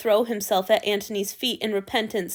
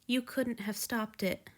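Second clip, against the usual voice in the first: much softer, barely voiced; breathed softly; 7 dB quieter than usual. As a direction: breathe it gently. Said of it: "Asao breathed".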